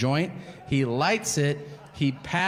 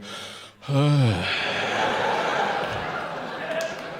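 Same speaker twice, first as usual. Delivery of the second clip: out-of-breath gasp. Shown as a demonstration. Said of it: "you're eating and you go".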